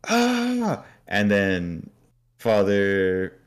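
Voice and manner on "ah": high-pitched hammed-up panic; mock scream. [name] asking about last night's thing, mock screaming. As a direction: scream it high, ending in a shriek. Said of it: "dying for him to scream that".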